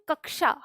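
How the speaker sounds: कक्षा said "kak-shaa", the half k audible; correct pronunciation. Shown as a demonstration. Teacher showing how the written letter sounds, कक्षा pronounced "kak-shaa". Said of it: "इसको हम बोलते हैं".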